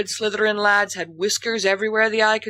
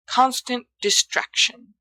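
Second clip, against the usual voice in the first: ordinary delivery, nothing marked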